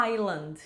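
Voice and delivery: "island" with no S sound, like "eye-land"; silent S. Shown as a demonstration. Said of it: "a gente não pronuncia o S". ("island" com S mudo)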